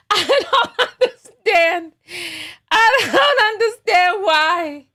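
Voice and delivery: slow, high, loud words through laughter; helpless laughing. Real, not acted. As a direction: crack up between drawn-out words. Laughing fit continues.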